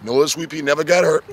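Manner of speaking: ordinary delivery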